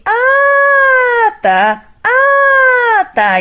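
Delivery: high, loud surprised ah then tá. Shown as a demonstration. Teacher demos head voice reacting to news, high ah. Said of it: "isso aqui é voz de cabeça".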